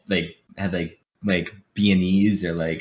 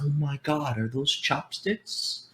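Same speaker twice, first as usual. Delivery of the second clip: mock teacher shock; 6 semitones higher, 9 dB quieter, slower than usual. Teacher reacting to chopsticks.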